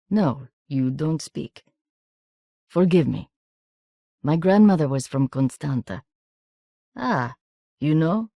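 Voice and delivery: put-on careful Romanian-accented English, starting slowly; apologetic foreigner with a gentle accent; soft foreign accent. A narrator's reading. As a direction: speak accented English in short, separate phrases. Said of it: "in his soft accent".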